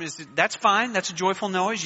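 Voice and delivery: ordinary talk, nothing marked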